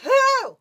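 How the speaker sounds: loud, very high yelp of disgust; squeamish squeal. Real, not acted. Recalling the mouse's little head, squealing loudly. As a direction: squeal as if seeing it afresh.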